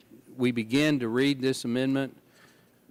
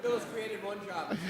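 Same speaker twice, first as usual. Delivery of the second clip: laughing; 10 semitones higher than usual. Real, not acted. Joke about speed reader job.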